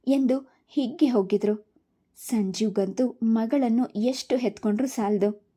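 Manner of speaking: usual speaking voice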